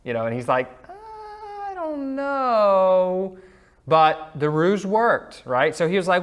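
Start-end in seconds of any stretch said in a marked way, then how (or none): 0.9-3.4 very high, drawn-out doubtful voice; doubtful Isaac. Acted. Blind father unsure which son this is, hammed-up doubt. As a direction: stretch it out high, full of doubt.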